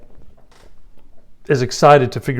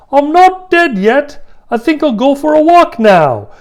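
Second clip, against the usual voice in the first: Monty Python; 13 semitones higher, 6 dB louder than usual. Acted. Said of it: "This is just like Monty Python".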